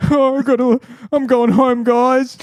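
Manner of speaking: high, loud, huffy goodbye; hammed-up wounded leaving; defeated exit. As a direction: storm off in comic defeat. Retold self leaving party.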